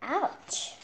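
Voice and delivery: high, startled yelp of pain; little ouch. Acted. Chick hit on the head, yelping ouch. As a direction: yelp as the acorn hits.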